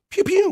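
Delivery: very high zappy pew pew; laser pew sound. Pretend. Host making laser sounds for the chat. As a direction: fire off a cartoon laser. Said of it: "We got the pew pews going".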